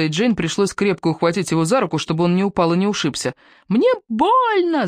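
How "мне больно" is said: put-on high slow squealing child; child's shriek. A narrator's reading. Little boy shrieks at mother holding his arm. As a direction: shriek it in pain like a small boy. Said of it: "заверещал Зигги".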